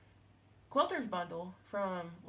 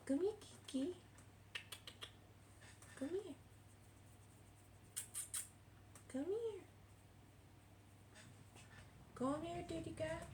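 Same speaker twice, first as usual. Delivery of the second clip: much higher coaxing pet call; calling the cat; 10 semitones higher, 9 dB quieter than usual. Real, not acted. Calling her cat over to the camera, high coaxing call.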